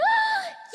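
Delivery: sharp high gasp; shocked gasp. Acted.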